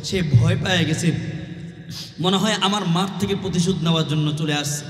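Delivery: ordinary talk, nothing marked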